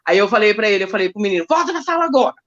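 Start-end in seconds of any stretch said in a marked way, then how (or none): 1.5-2.3 a raised, firm command, higher pitched; firm shout. Retold or imitated. Teacher ordering the student back to class, firm raised voice. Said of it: "Dei um grito lá com o menino".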